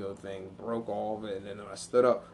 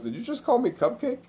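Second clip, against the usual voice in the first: mock indignant; 12 semitones higher, 4 dB louder than usual. Stepdad quoted incredulous.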